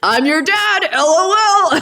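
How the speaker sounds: high, loud mock yell; all-caps shout. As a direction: read it out like a text in capitals. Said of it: "all caps writing".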